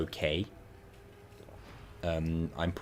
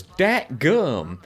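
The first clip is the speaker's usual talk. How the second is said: hammed-up shocked exclamation, pitched up; Mater in shock; 7 semitones higher, 6 dB louder than usual. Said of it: "in shock of being found out".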